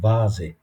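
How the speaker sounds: "ভাজে" opening on voiced V; foreign-word V sound. Shown as a demonstration. Teacher gives a foreign loanword example, voiced V sound. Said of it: "ওইটা ভায়ের মতন হবে".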